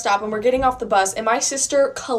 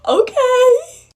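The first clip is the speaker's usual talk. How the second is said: high, loud squealed okay; giddy squeal; 13 semitones higher, 6 dB louder than usual. Retold or imitated. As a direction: squeal it out with delight. Sister's delighted reply to Isaiah.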